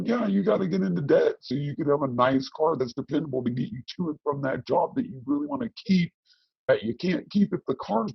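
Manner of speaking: mock the voice of common sense; imitating normal people. Guest imitating what normal people would tell her, light mock voice. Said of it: "They go".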